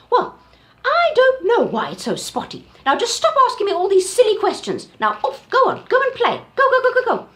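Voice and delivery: very high, loud, fast shooing; shooing Auntie Giraffe. Acted. Auntie Giraffe shooing the elephant off, very high and loud. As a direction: shoo the pest away with a laugh.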